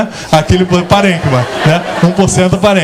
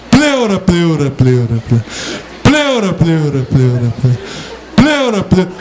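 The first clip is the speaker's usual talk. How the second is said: fading echo; 3 semitones lower than usual. Shown as a demonstration. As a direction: echo bouncing back and fading. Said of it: "o eco da pleura". (eco da pleura sumindo)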